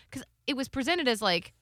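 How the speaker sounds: usual speaking voice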